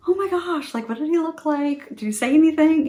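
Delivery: high, eager questioning; re-enacted giddy delight, voice lifted high; excited mom reaction. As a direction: pump your kid for crush gossip. Mom eagerly reacting to son's news.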